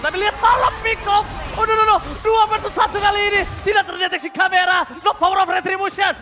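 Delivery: excited shouting play-by-play; esports caster. Real, not acted. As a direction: hype caster yelling, high and loud. Caster erupts at surprise pick off.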